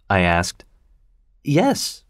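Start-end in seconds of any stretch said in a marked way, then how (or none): 1.4-2.0 high, mildly startled yes; a quick, lightly surprised reply. Acted. Estella answering Pip, mildly surprised. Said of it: "she replied, surprised".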